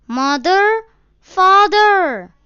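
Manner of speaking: put-on high, loud frightened child's call; lost boy calling loudly for his parents; crying aloud. A narrator's reading. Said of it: "he cried".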